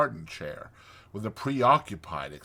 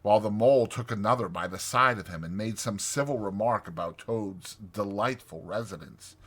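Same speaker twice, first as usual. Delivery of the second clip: usual speaking voice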